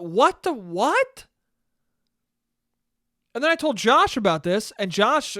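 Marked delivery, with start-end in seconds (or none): 0.0-1.3 slow, higher-pitched bewildered question; baffled disbelief. Retold or imitated. Son looking at his mom, baffled and incredulous. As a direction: draw it out, rising in confusion.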